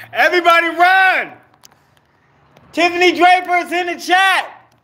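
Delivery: high, loud mock alarm; screaming fake terror at the chat; mock panic. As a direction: shout a hammed-up warning to flee. Panic alarm at Tiffany joining chat.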